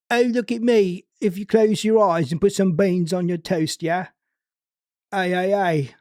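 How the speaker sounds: hammed-up cheeky British voice; mock British accent. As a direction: playfully overdo the British accent. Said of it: "Unless you're like".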